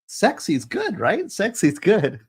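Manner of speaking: playful sexy's-good. Acted. Author teasing her chat that sexy's good, playful high tease. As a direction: tease the viewers, lilting way up high. Teasing reply about sexist title.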